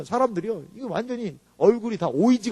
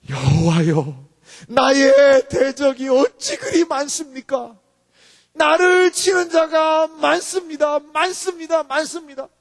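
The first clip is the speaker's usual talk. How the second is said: slow, drawn-out cry to God; pleading scripture recitation, easing at the end; impassioned psalm lament; 7 semitones higher, 5 dB louder, much slower than usual. Acted. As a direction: declaim it higher, stretching the words. Reading David's lament aloud.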